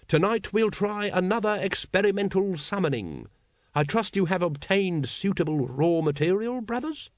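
high, stately cult leader; ceremonial address. A narrator's reading. Grand Master addresses brothers about a summoning, ceremonial. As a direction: announce it grandly, lifting into the final question.

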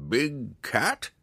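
put-on high curious old hermit; old man voice. A narrator's reading. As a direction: wonder aloud in the hermit's voice. Old man Montaleo speaks.

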